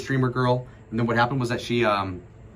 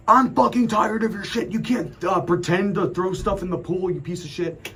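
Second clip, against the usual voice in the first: retold furious yell; 9 semitones higher than usual. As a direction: scream it right in his face. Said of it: "he starts yelling at me".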